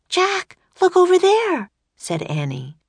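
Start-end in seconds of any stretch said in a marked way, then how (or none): 0.1-1.7 high, bright little sister calling Jack; girl's voice. A narrator's reading. Annie calling to her brother in a much higher voice. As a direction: pitch up high for the young girl.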